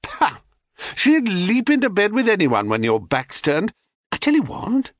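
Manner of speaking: high, dismissive character voice; scoffing goddess. Acted. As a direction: scoff at the naive lover, pitch well up. Goddess Selene scoffing at Cephalus.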